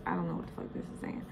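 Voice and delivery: under-breath whispered aside to herself. Real, not acted. Reading a confusing card, she whispers to herself. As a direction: drop to a breathy whisper, puzzled.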